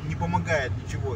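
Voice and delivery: ordinary talk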